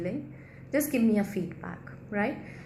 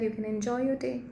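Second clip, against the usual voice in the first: ordinary talk, nothing marked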